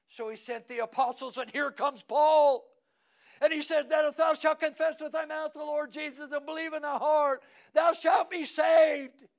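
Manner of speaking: very high, very loud pulpit shout; shouted preaching climax. Acted. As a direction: cry it out to the whole church.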